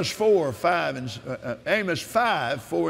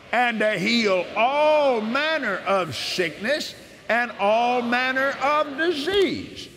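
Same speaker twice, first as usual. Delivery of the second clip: slow, solemn reading of God's promises; measured devotional scripture reading; scripture proclamation; 7 semitones higher than usual. Acted. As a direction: proclaim each verse as a promise. Reads healing scriptures aloud.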